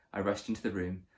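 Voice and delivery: usual speaking voice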